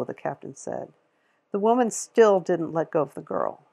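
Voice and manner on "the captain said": ordinary delivery, nothing marked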